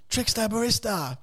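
high, drawn-out mock holler; imitated shout across store. Stranger screaming to him from the fishing section, mock holler. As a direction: imitate a stranger calling out from down the aisle. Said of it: "screaming up to me".

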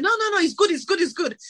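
high-pitched, mock-insistent shop assistant brushing her off; shop assistant insisting. Shop guy pushing a gel on a customer, high mock insistence. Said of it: "And he said".

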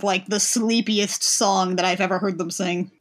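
ordinary delivery

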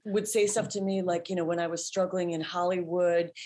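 normal talking voice

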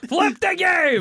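very high, drawn-out comic yell; hammed-up high-pitched shouted command; mock yelling. Playing a game designer demanding a flip, mock yelling. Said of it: "stop yelling".

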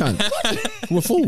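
high, hammed-up Aussie accent; mock Aussie yell. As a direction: play a mock-furious Aussie local.

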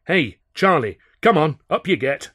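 loud, high call to get up; rousing call. Acted. Narrator calling Charlie up from his drunken stupor, loud. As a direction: coax him up with a shout.

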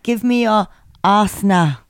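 put-on Nigerian accent, hammed-up; mock Nigerian accent. Host teasing co-host about ex-boyfriend, mock Nigerian accent. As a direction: imitate a Nigerian boyfriend, jokingly. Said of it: "that did sound bloody good".

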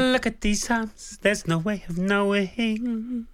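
high, slow crooning. Retold or imitated. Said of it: "[name]'s the only one I can do properly".

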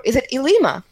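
normal talking voice

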